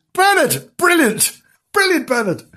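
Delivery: very high, sarcastic cheering; high-pitched mock praise; mock exclamation. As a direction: exclaim shrilly at a silly remark. Narrator mocks the character's silly remark.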